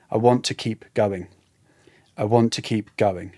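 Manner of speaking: ordinary delivery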